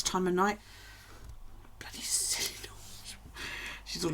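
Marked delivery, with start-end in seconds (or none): 1.8-2.7 exasperated whisper; whispered grumble. Real, not acted. Whispering a grumble at the dog late at night. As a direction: grumble it under your breath.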